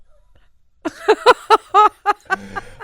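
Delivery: big burst of laughter; loud cackling laugh; loud high laugh. Real, not acted. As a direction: laugh hard at the memory. Laughs at own story.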